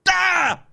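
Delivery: loud high groan of frustration at the salesman; frustrated yell. Retold or imitated. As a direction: let out an annoyed yelp. Reaction to being corrected.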